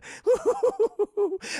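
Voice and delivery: high hooting villain laugh; loud, high staccato titter; Joker giggle. Acted. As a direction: maniacal mock giggle.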